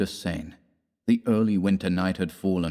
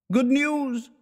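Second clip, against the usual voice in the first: much higher, shouted good news. Acted. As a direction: call it across the boulevard. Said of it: "a man shouted".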